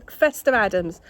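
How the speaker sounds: a sharp, loud call of the cat's name; scolding call. Real, not acted. Owner scolding her cat Fester Adams, loud and sharp.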